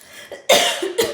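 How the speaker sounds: sharp throat-clearing cough. Real, not acted. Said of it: "that I'm coughing".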